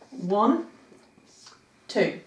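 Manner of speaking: one and two counted out slowly with pauses; counting syllables. Shown as a demonstration. Teacher counting syllables for students. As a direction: count each beat of the name apart. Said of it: "it has two syllables".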